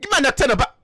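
put-on high, agitated African accent; indignant outburst in a put-on African accent. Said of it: "so you don't have an African accent".

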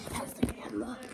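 hushed whisper. Real, not acted. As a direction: whisper it like a secret. Whispering game update.

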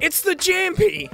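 "It's the Jam P" pitched high and proud; Aussie abbreviation. Shown as a demonstration. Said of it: "the Aussie in me has to abbreviate it".

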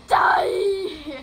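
high, loud drawn-out yell; battle shout. Real, not acted. Gamer attacking an enemy, battle shout.

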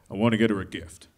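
low, lightly voiced Beast; a hint of the gruff Beast. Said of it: "the Beast is like".

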